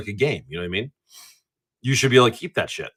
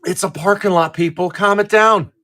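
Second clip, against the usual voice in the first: higher-pitched scolding plea; exasperated rant; 6 semitones higher than usual. Real, not acted. Ranting at parking lot speeders, exasperated scolding. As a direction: scold them, rising higher on the last words. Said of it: "this is an old man rant right now".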